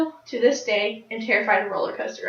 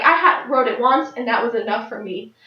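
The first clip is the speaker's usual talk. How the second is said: ordinary talk, nothing marked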